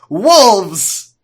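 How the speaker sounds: loud, high triumphant cry; mock dramatic discovery. Rose reading animal names finds wolves, mock excited cry. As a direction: burst out with the big find. Acted discovery at list's end.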